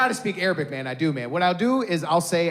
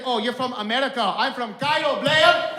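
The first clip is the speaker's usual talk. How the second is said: Egyptian shopkeeper; 7 semitones higher than usual. Retold or imitated. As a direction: taunt in a mock Egyptian accent.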